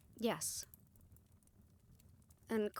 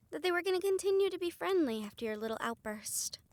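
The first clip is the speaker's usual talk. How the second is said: sarcastic, raised pitch; 7 semitones higher than usual. Acted. Sarcastic rebuke.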